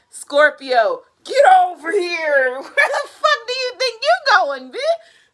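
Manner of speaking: high, hammed-up summons with a laugh; bossy twin-flame impression. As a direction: imitate someone indignantly calling her back. Acting bossy twin flame calling her.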